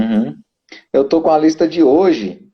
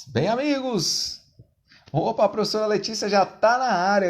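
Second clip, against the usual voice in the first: Globo sportscaster; 7 semitones higher, 8 dB quieter than usual. Retold or imitated. Host opening the live like a TV sportscaster. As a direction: greet the audience like a Globo sports anchor. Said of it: "Tô parecendo aquele pessoal lá do, da Globo".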